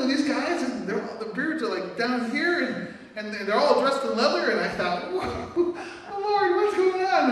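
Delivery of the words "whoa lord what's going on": high, breathless whoa, then a slow plea to the Lord; a nervous prayer under your breath; alarmed surprise. Retold or imitated. Pastor alarmed among bikers.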